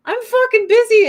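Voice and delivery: high, loud, fed-up snap; annoyed yell. Retold or imitated. Yelling at the cat that wants attention while busy. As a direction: yell it like you're swamped. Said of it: "I'm like".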